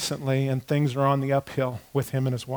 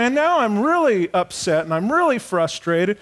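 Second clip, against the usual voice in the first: ordinary delivery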